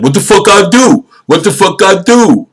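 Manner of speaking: a hammed-up frantic arrestee; mock panicked arrestee. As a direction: play the guy yelling at the cops. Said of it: "Wasn't saying that like".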